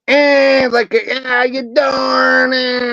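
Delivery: put-on a high, shouted New York greeting in a New York accent; hammed-up New York accent, shouted. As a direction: call it out like a New York guy. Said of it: "it's more like a".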